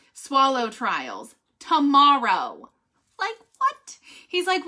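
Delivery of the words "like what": high incredulous what; stunned disbelief. Real, not acted. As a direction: pitch shoots up in disbelief. Disbelief at news.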